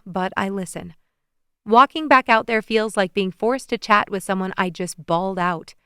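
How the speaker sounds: ordinary delivery, nothing marked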